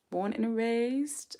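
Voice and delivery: slow mock British question; mock British accent. Shown as a demonstration. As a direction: play at a posh British accent, stretching each word. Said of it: "I'm curious, darling".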